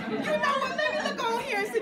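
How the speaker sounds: very high, loud, laughing play-along; playful high answer. Retold or imitated. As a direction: sing it up high, grinning. Playfully checking for trainings.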